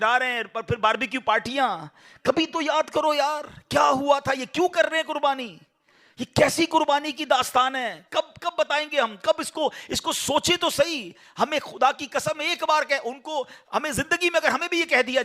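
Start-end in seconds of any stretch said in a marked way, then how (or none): 2.2-13.2 impassioned appeal. Real, not acted. Preacher rebukes congregation.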